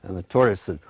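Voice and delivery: ordinary delivery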